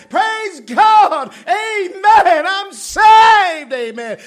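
high, shouted, laughing praise of a saved man; saved jailer rejoicing. Retold or imitated. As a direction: shout it like the jailer who just got saved. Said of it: "he's saying".

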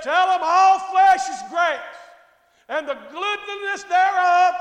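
loud, slow verse; emphatic scripture preaching. Real, not acted. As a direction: proclaim the verse with force, stretching it.

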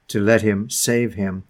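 normal talking voice

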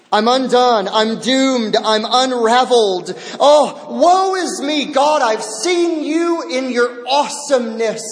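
Isaiah crying out before God, desperate. Retold or imitated. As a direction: build the lament to a cry. Isaiah's cry escalates.

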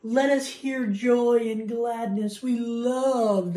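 high, slow, drawn-out mock bliss; mock angelic rapture. Movie moment when clouds part, mock rapture. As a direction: draw it out dreamily, heavenly and hammed-up. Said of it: "angelic music comes out".

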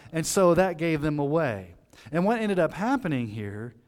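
ordinary talk, nothing marked

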